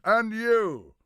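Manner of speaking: high, loud comeback; shouted retort. Acted. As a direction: hurl the insult straight back. Acted insult thrown back at Harold.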